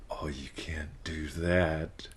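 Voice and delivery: slow, soft seductive murmur. Shown as a demonstration. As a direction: purr it slowly and softly, half-whispered. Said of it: "either Mickey Mouse or seductive".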